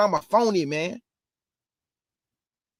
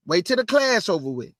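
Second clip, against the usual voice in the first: ordinary talk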